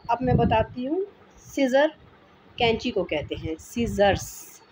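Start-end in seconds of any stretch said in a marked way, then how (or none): 3.6-4.6 सीजर्स drawn out slowly, ending in 's'; final S sounded. Shown as a demonstration. Teacher giving the English for scissors, final S sounded. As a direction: stretch सीजर्स and sound the last S. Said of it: "आखिर में एस को include किया जाता है".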